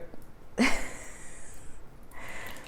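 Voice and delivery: soft, breathy giggle; amused, voiceless real laugh. Real, not acted. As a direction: laugh quietly mid-explanation. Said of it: "I'm laughing because".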